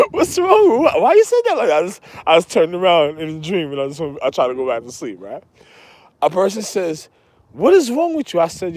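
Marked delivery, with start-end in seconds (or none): 0.1-1.8 higher, louder puzzled voice; incredulous questioner. Retold or imitated. Voicing the stranger who wakes him, in a high puzzled voice. 7.5-8.4 incredulous questioner. Retold or imitated. Quoting a puzzled person.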